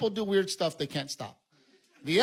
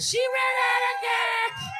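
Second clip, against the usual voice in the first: shouted punchline; much slower than usual. Shown as a demonstration. Comic repeating his punchline to the crowd, slow high shout. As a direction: yell "She ran out of gas" stretched and high. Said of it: "you gotta repeat it".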